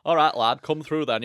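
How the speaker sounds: a hint of a friendly Yorkshire local; northern English greeting. Locals up north welcoming a visitor, light northern English accent. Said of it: "if you go up north".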